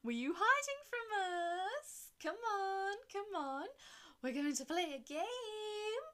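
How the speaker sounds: high, slow, loud teasing voice; sing-song taunting. Acted. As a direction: tease like a creepy host.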